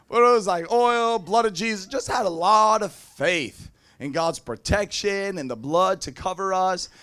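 usual speaking voice

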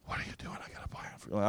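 hushed, unvoiced and gentle; compassionate whisper. God repeats his gentle question, whispered with compassion. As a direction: whisper it tenderly close to the ear. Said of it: "it was so compassionate".

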